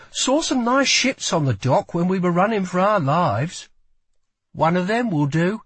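high-pitched character voice, casual bravado; Lila, making light of it. Acted.

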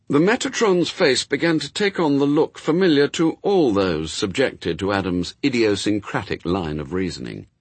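usual speaking voice

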